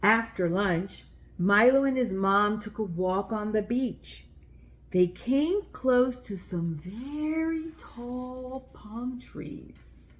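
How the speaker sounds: put-on gentle read-aloud for little kids; children's story reading. A narrator's reading. Reading a picture book aloud.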